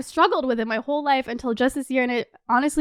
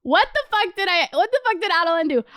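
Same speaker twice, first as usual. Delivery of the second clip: self-scolding disbelief; 6 semitones higher, faster than usual. Real, not acted. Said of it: "cringing at own behavior".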